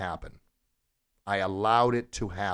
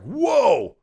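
huge, much higher roaring whoa; imitating Mufasa's roar; 13 semitones higher, 8 dB louder than usual. Retelling Mufasa roaring behind Simba, loud roar. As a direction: roar it out loud like the big lion. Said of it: "and it's like".

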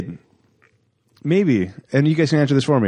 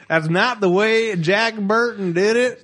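worked-up protest; 7 semitones higher, slower than usual. Real, not acted. Heated argument over voice.

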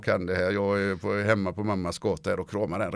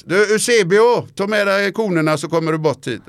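retold call across the pitch; 9 semitones higher, 8 dB louder than usual. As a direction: shout a name across a training field. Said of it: "så ropar han så här".

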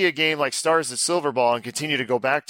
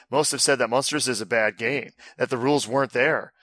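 normal talking voice

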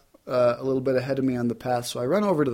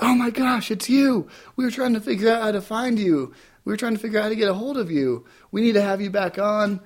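high, thrilled imitation of a station caller; station staff thrilled he called back; excited staff; 8 semitones higher, faster than usual. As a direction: gush like they finally found you. Station staff recognizing him.

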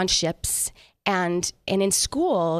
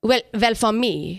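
put-on German accent; 3 semitones higher, slower than usual. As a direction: play the stern German band character in a hammed-up German accent. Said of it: "Give us your best German accent".

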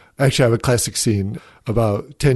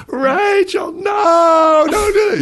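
very high, loud screamed warning; retold panicked yell; 7 dB louder, slower than usual. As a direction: yell it out as a mother stopping her toddler. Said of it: "she yelled".

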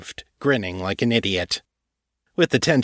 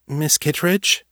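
higher, timid piping question; small uncertain voice; 7 semitones higher, slower than usual. A narrator's reading. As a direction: pipe up hesitantly. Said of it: "a small voice piped up uncertainly".

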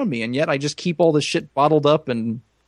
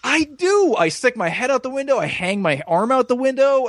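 excited dog glee; 9 semitones higher than usual. Pretend. Playing happy golden retriever.

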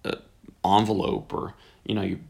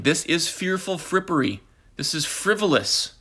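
mock indignant pastor; 7 semitones higher than usual. Mocking pastor's indignant reaction.

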